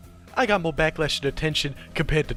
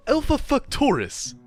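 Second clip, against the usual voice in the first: incredulous; 10 semitones higher, slower than usual. Acted. Skit, repeating in disbelief.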